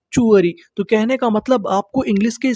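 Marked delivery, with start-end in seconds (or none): 0.1-0.5 चुअरी said as "chuari", ch sound not t; teacher says चुअरी as "chuari"; correct pronunciation. Shown as a demonstration. Teacher shows students the right sound, चुअरी as chuari. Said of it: "इसका सही उच्चारण क्या है".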